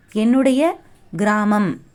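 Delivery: normal talking voice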